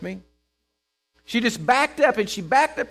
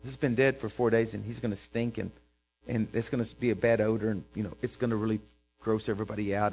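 low, soft, awkward aside; mock reluctant objection; 7 semitones lower, 6 dB quieter than usual. Preacher voicing Martha objecting to the stone's removal, low reluctant mumble. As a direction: object timidly, trailing along under your breath.

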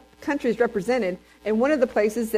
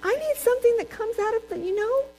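incredulous; 11 semitones higher than usual. Retold or imitated. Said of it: "I'm like".

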